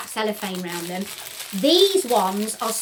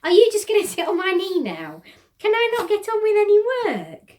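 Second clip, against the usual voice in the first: high, loud pet-talk complaint; mock-scolding a pet; 10 semitones higher, 6 dB louder than usual. Real, not acted. Owner to pet on her knee, playful high scolding.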